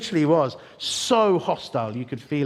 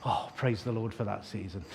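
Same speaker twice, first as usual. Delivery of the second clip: ordinary delivery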